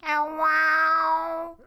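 high imitated cat yowl. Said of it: "weird cat sounds".

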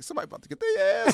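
high, fast, furious outburst; retold fury. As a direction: blurt it like you're about to snap. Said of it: "I'm like".

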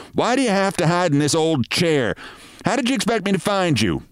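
wife scolding her dreamy husband; nagging wife. Acted. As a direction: tell him off impatiently.

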